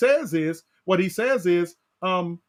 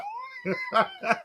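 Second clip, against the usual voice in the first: high laugh; 5 dB quieter than usual. Real, not acted. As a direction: burst of real high laughter. Preacher laughs at congregation.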